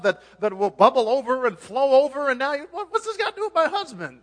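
high, loud comic outburst; hammed-up bewildered yelling; mock indignant shock. Acts the startled woman's outburst.

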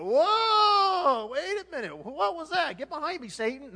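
high, startled recoil; hammed-up shocked reaction; mock alarm. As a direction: yelp out, then protest in a high voice. Acts believer startled by God.

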